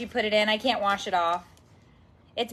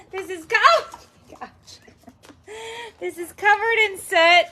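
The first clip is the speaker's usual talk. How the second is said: louder, higher cries of disgust; disgusted shock; 10 semitones higher, 7 dB louder than usual. Real, not acted. Mom exclaims at the sooty ball for her family. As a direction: react loudly to the filthy find.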